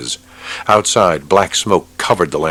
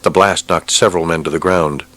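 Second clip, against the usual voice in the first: ordinary delivery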